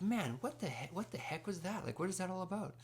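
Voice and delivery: high-pitched, baffled retold disbelief; baffled reaction. Student reacting to the officer's call, baffled. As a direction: replay your bewilderment in a high voice.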